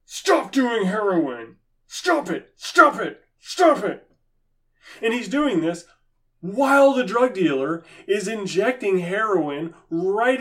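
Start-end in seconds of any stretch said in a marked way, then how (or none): 0.0-4.1 mock scolding pastor. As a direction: yell at him like a helpless pastor. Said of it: "the pastor is just staring at the addict going".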